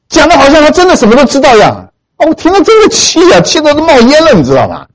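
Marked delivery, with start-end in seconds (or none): 2.2-4.3 fuming. Retold or imitated.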